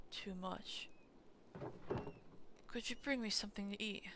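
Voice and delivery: put-on quick whispered request from a weak old man; frail father voiced. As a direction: whisper it fast like a bedridden dad. Voicing the sick father.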